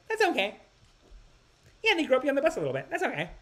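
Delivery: very high mock women's voice; high-pitched imitation, casually dismissive; mimicking blasé women. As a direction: squeak out a breezy, quick brush-off.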